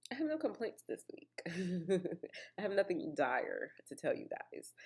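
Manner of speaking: normal talking voice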